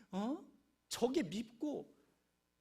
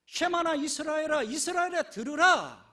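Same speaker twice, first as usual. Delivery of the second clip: loud, higher ringing proclamation; proclaimed call; 5 semitones higher, 13 dB louder than usual. Acted. Preacher proclaiming God's call to Israel, loud and high.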